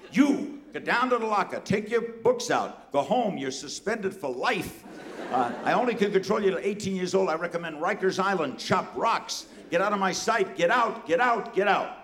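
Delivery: high, irate tirade in short bursts; screaming principal. Retold or imitated. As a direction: bellow orders like an apoplectic principal. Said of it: "He's screaming".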